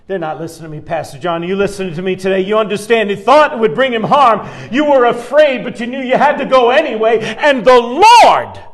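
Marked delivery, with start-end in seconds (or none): none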